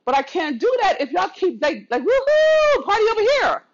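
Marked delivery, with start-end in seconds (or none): 2.0-3.6 squealed high, louder mock party cheer; mock the careless partygoers, high and loud; mocking party crowd. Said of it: "That's not sourceful".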